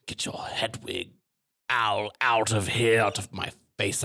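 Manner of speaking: haughty snobbish schoolboy; dismissive sneer at a rival; posh sneer. Retold or imitated. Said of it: "I'm a Slytherin".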